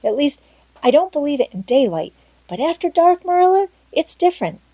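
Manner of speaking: put-on rising, earnest girlish insistence; eager girl Anne. A narrator's reading. Plays Anne pleading.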